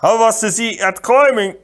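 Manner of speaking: a big polite man, puzzled; giant voice. Acted. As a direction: giant complaining to the Sicilian on his neck. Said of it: "Fezzik said".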